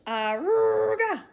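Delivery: slow, high, loud horn call; loud honk call. Acted. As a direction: blast it like a bus horn. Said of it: "Harry Monster honked his horn".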